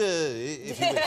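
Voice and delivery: normal talking voice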